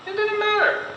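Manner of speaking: plain reading voice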